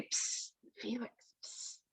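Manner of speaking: soft secretive whisper; unvoiced gossiping whisper. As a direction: hiss the name behind his back. Friends whispering about him.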